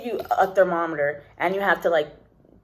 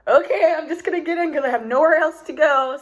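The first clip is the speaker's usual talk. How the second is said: resigned giving in; 8 semitones higher, 4 dB louder than usual. Retold or imitated. Resigned acceptance.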